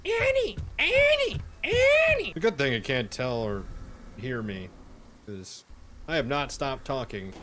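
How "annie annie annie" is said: very high shrieking call; shrieked name. Acted. Gamer shrieking the name, very high and loud.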